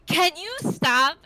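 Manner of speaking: high, loud and exasperated; begging a friend to quit stalling; exasperated plea. Retold or imitated. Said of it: "she was like".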